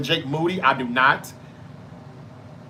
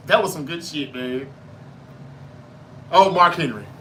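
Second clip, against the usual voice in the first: usual speaking voice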